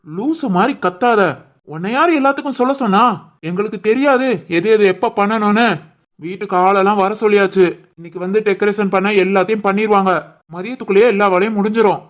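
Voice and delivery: husband loudly scolds his wife back; angry retort. Acted. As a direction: shut her down, loud and quick. Husband snaps back at nagging wife.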